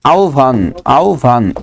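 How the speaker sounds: আহ্বান as "au-bhan", pitched low; correct pronunciation. Shown as a demonstration. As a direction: repeat আহ্বান with an au glide and breath on "bhan". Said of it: "আহ্বান কি হবে".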